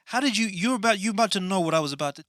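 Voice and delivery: loud, high, rushed objection; flustered protest. Pretend. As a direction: blurt it out, comically exasperated. Flustered comeback in comic squabble.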